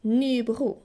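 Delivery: Nybro with a southern Swedish throat R; French-like R. Shown as a demonstration. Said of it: "A little bit like the French R".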